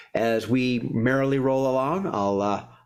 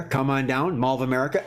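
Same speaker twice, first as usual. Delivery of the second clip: ordinary talk, nothing marked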